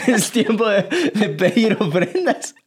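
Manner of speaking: high voice breaking into laughter, slowed; can barely finish for laughing. Real, not acted. Dad imagining his son collecting offerings, laughing.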